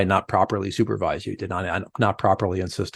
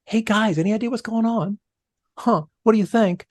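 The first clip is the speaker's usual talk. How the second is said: light, high, inquiring boss voice; raised curious voice; 11 semitones higher than usual. Retold or imitated. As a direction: ask the team with raised curious pitch. Leader voicing a curious question.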